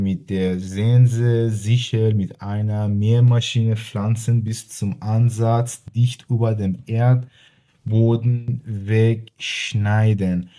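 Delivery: German definition read word by word, slowly; teacher reading a German definition very slowly; slow careful reading. Shown as a demonstration. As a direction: read it slowly and clearly for the class. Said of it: "معنیش نوشته که".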